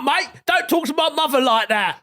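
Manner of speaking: hammed-up East End row; mock EastEnders. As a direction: play a soap hardman.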